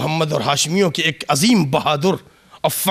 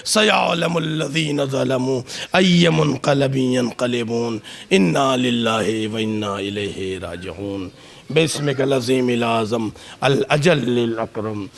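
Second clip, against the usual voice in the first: low, hushed supplication; closing prayer; 10 semitones lower than usual. Acted. (धीमी, दबी आवाज़ में दुआ)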